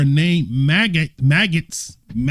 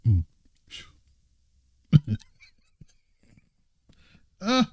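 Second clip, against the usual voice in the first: ordinary talk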